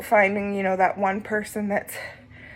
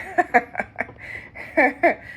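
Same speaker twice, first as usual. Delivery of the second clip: light laugh; 5 semitones higher than usual. Real, not acted. Laughs at own complaining.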